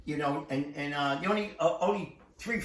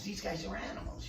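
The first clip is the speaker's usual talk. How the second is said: ordinary delivery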